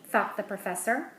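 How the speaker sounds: ordinary delivery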